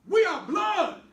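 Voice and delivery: loud, high "We are blood!"; shouting. Acted. Brother shouting high and loud that family must stick together. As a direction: bellow it with fury.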